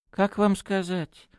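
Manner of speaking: high, slow, apologetic hedging; guilty. A narrator's reading. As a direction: begin reluctantly, voice lifted high. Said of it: "виновато начал Браун". (виновато, высоким голосом)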